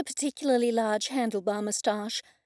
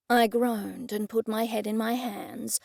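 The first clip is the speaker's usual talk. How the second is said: plain reading voice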